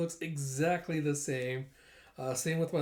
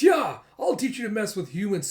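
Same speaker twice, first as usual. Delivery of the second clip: loud, higher villain outburst; acted angry threat; 6 semitones higher, 8 dB louder than usual. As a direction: threaten the dog in mock rage. Arsonist threatens dog with knife.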